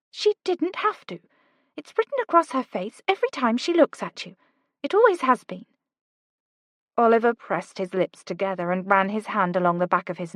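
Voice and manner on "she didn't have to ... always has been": put-on lightly higher, urgent young woman; Kate's voice. A narrator's reading. Kate urging Oliver to go, lightly higher voice. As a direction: push him, brisk and bright.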